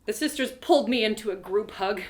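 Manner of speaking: usual speaking voice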